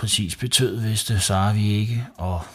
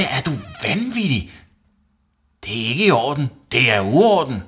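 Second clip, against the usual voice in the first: high-pitched, indignant telling-off; outraged scolding; 7 semitones higher than usual. A narrator's reading. Mum questioning Savi about the mess, outraged. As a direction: scold with mounting disbelief. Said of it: "råbte mor videre".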